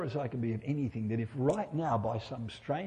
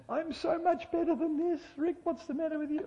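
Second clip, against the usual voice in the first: very high whiny self-scolding voice; mock self-reproach. Preacher mimicking his own self-disappointment, high mock scolding. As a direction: act out berating yourself in a squeaky pitch. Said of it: "a large dollop of self-disappointment".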